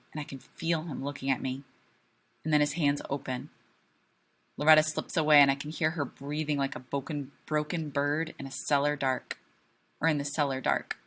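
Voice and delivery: usual speaking voice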